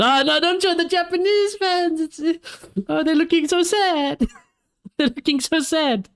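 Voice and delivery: high, slow mock-pitying whine; mock sad whine. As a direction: coo over the sad fans, hammed up and slow. Teasing about sad Japanese fans.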